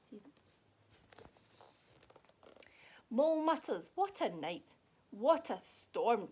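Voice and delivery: lively, sing-song picture book read; reading to children. A narrator's reading. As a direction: read it like a bedtime story for kids. Reads picture book aloud.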